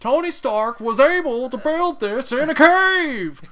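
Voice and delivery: slow, high-pitched full-volume yell; hammed-up roar of rage, stretching every word; angry movie quote. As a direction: bellow it like a furious movie villain. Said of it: "he's so angry".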